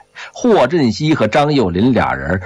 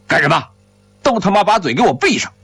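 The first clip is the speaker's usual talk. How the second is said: higher-pitched, fast scolding order; stern scolding; 8 semitones higher, much faster than usual. A narrator's reading. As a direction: scold them into shutting up. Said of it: "边走边呵斥着". (厉声呵斥，语速快)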